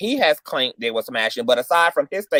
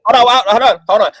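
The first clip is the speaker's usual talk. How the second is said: loud; 8 semitones higher, 9 dB louder than usual. Real, not acted. Cutting off the guests.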